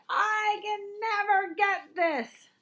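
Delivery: high, slow whiny complaint on never; frustrated whine. Acted. Teacher showing the fixed-mindset complaint to avoid. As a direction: drag it out like a stuck kid. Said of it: "when you're saying something like".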